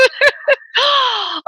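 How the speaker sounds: giggling burst; real laugh. Real, not acted. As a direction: laugh with happy surprise. Laughs on realizing who called.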